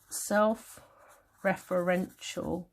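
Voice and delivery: very slow, drawn-out words; dictating while writing. Shown as a demonstration. Teacher writing a note, very slow drawn-out speech. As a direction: spell it out slowly as you write. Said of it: "I'm gonna write that".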